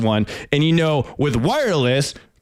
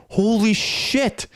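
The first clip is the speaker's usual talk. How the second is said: slow, drawn-out, higher gasp of disbelief; awed mock reaction, pitched up; stunned holy shit; 8 semitones higher, much slower than usual. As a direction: let it fall out of you in slow shock. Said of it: "I'm like".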